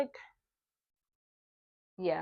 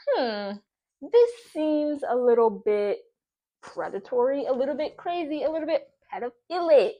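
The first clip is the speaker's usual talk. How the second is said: louder, put-on voice to call him out; mock-concerned girlfriend, louder; pointed mock voice; 3 semitones higher, 6 dB louder than usual. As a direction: hold each "a little bit" in a knowing put-on voice. Her retold quote, loud and put-on.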